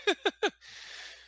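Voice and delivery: a high, breathy giggle; laugh. Real, not acted. Laughing about finally finding people who care.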